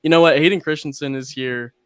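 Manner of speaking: loud burst of reckless decision; sudden resolve. Retold or imitated. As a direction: blurt it out like a dare to yourself. Deciding to splurge on photo.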